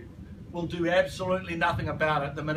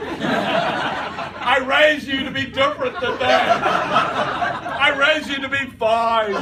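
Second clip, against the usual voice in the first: loud, higher, drawn-out mock reproach; scolding parent; 5 semitones higher, 6 dB louder, slower than usual. Parent scolds upbeat child, loud comic indignation. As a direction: play an indignant parent for laughs.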